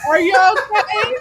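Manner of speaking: high, slow question through laughter; laughing. Real, not acted. Co-host checks on friend who fell off the ball, laughing. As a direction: ask it while cracking up.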